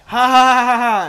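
loud, high fake laugh; imitated loud laugh. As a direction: mimic someone bursting out laughing over their reading. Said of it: "they're like".

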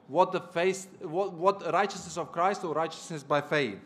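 normal talking voice

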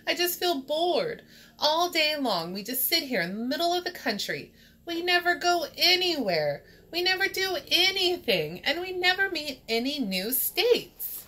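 high, whiny complaint; drawn-up, hammed-up moan; bored moaning. A narrator's reading. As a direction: whine about being stuck and bored. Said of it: "moaned Kansas".

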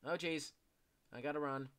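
ordinary delivery

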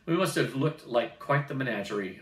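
plain reading voice

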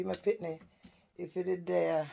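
ordinary talk

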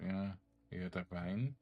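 ordinary talk

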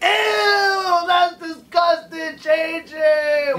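high, slow mock disgust; fake disgust. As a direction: squeal like you're grossed out. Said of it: "act like she was gross".

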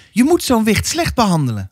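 high-pitched, insistent counsel; advising neighbour woman. A narrator's reading. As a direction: give harsh advice in a high woman's voice. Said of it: "had ze aangeraden". (dringend raadgevende buurvrouw, hoog)

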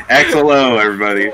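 laughing, loud announcer-style call; laughing hype. Real, not acted. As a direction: cheer it to the crowd. Hype after the combo.

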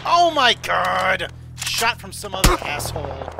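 loud, drawn-out yell of surprise; shocked shout. Real, not acted. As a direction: yell out when you get shot. Shot during a firefight.

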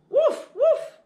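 high, loud playful puppy woof; puppy barking. Acted. Teacher reading to children, barking as the puppy: high loud woofs. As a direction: bark like an excited little dog.

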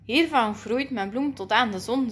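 usual speaking voice

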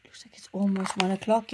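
soft whispered aside; whispering. Real, not acted. Unvoiced aside.

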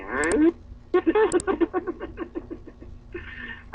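high burst of laughter; laughing. Real, not acted. Friend mocking the other, laughing hard. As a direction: laugh out at the teasing.

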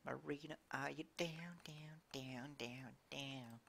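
soft eerie taunting whisper; spooky whisper. Pretend. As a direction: whisper it like a ghost haunting. Whispered teasing of Marina.

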